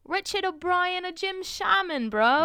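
high mock-British agent; put-on British slang accent. Agent names the auditioners, hammed-up British accent. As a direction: pitch the British accent up, playfully overdone. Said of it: "the agent is from different places".

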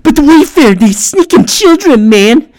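high, loud mock hysteria; mock panicked shout; ranting conspiracy theorist. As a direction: imitate a frantic conspiracy nut.

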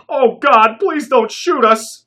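high, loud, slow quivering plea; fearful pleading. A narrator's reading. Thief begging Dave and Jacques not to shoot, terrified plea. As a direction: beg for your life, voice shaking. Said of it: "his voice quivering with fear".

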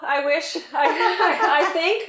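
laughing through the words; giggly and embarrassed. Real, not acted. Laughing while answering compliment.

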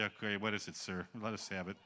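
low, soft, defeated reply; resigned. Retold or imitated. Preacher answering the mayor, resigned and quiet. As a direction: give in quietly, bracing for it.

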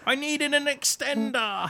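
very high, drawn-out mock complaint; Moss. Plug hero lacking an extender, high slow impression. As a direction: stretch it out, pitched far above your own. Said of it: "sound like Moss".

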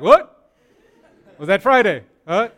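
loud startled what, half laughing; confused surprise. Pretend. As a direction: play a puzzled man caught off guard. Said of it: "is looking at me like".

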